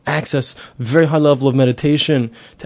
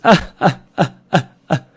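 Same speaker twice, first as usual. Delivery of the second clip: ambiguous laughing-crying ah ah; laugh-cry sound. Shown as a demonstration. Teacher showing laughing and crying sound alike, ambiguous gasps. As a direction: make a sound between laughing and sobbing. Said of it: "It sounds like this".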